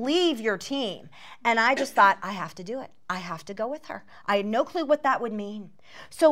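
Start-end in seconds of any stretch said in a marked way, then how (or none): 2.2-4.0 low, hushed inner voice; quiet resolve. Retold or imitated. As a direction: think it softly to yourself, low. Retold private resolve to go along.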